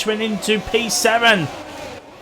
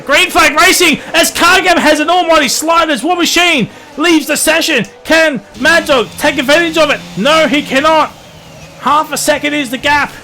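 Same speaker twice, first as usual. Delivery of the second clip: fast, raised live race calling; play-by-play commentary; 6 semitones higher, 4 dB louder than usual. Real, not acted. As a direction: call the race like a caster.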